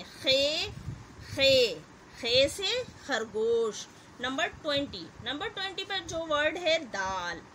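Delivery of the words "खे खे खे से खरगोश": खे called out loud, then a slow खे से खरगोश; stressing the letter. Shown as a demonstration. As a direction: sound out खे for the class. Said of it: "दोनों का sound भी same है". (खे को ज़ोर से और धीरे बोलना)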